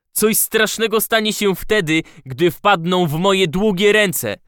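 high, loud menacing threat; furious threat. A narrator's reading. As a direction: threaten them with fury. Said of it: "zapowiedział rozsierdzony chudzielec".

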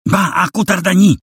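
very high, piercing whistle-like wail; wailing high-pitched cry. A narrator's reading. Terrified young woman wailing at the intruders, piercing high cry. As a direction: shriek it like a terrified girl. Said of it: "krzyknęła jękliwie".

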